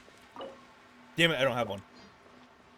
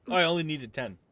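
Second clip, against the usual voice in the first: ordinary talk, nothing marked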